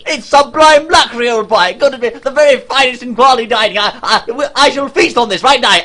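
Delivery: hammed-up posh British delight; excited posh gasp. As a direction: marvel at the breakfast like a thrilled old toff.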